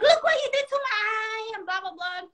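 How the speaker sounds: whiny high-pitched mimicry, fading off; mocking wail. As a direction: imitate someone wailing over an injury.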